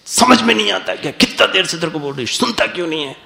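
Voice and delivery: put-on high, fast scolding of a child; angry mother. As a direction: snap at the kid who won't listen. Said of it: "बच्चे को गुस्सा करके कहते".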